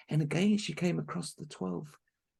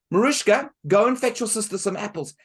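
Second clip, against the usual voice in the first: loud, higher bossy order; bossy stepmother; 7 semitones higher, 12 dB louder than usual. Acted. Stepmother ordering Marushka out, loud and bossy. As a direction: order her about.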